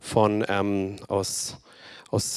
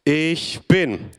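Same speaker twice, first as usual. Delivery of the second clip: call and response; 3 semitones higher, much slower than usual. Acted. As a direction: drive each phrase home for the room to echo. Leads call and response proclamation.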